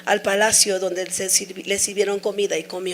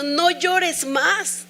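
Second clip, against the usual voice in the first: "No llores más" drawn out very slowly, pitched up; slow tender reading; 7 semitones higher, much slower than usual. Retold or imitated. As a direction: linger on "No llores más" like comfort. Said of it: "yo era llorar y llorar y leí eso".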